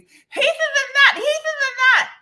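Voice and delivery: very high, loud scream. Retold or imitated. Allergic friend screaming the warning at her. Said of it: "her screaming at me".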